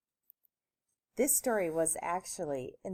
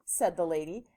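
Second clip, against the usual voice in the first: usual speaking voice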